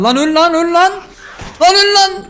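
high-pitched, rising frantic yelling; frantic battle shouting. Real, not acted. Player yelling at attackers to die, frantic shouting. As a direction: yell it mid-fight, pitch climbing.

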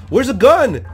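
loud, high-pitched scared question; scared yelp. Real, not acted. Player searching for a weapon, scared yelp. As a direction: yelp it in a sudden fright. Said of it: "Okay, I got scared".

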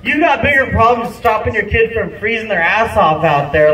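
normal talking voice

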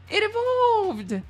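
high gasp then it evolved; startled delight at the card; sudden high surprise; surprised gasp. Real, not acted. Evolution card appears.

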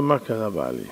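soft, low concerned question; gentle wife. Acted. Wife gently asks her upset husband what happened. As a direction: ask tenderly.

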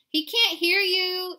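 high, loud, slow singsong; little sisters chanting it out in a lilt. A narrator's reading. Sisters to mama below the wire, high singsong. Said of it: "sang his sisters".

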